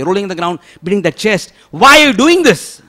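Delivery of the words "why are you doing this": high, loud wailing question; anguished cry. Retold or imitated. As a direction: cry out in grief at him. Townspeople wailing at the haircut.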